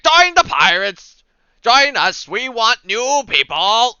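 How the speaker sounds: loud, high, drawn-out recruiting call; burly crier shouting. Acted. Burly fella on a milk crate recruiting pirates, shouting. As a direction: holler it slowly to a crowd. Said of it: "He's like".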